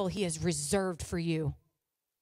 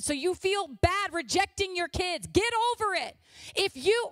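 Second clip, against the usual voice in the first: preaching at full force; 6 semitones higher than usual. Acted. Pastor's wife exhorting the congregation, preaching at full force. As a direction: drive it home to the congregation.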